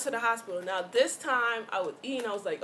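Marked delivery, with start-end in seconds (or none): none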